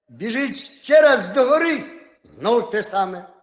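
usual speaking voice

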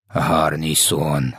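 very slow, low echo of the question; mock exclamation, understated. A narrator's reading. Giant echoes Sophia's question, low drawn-out exclamation. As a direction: stretch both words out low and incredulous. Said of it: "заволав В Д В".

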